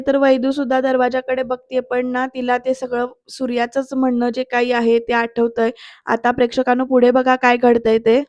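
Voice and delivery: ordinary delivery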